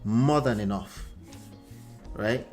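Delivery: ordinary delivery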